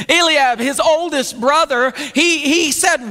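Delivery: ordinary delivery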